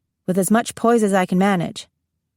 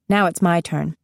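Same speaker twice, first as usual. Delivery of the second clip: plain reading voice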